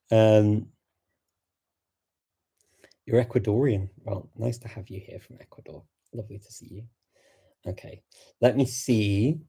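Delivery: ordinary delivery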